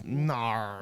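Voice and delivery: drawn-out Aussie "nar" for no; mock Australian "nar"; Australian accent. Shown as a demonstration. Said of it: "All I know is nar".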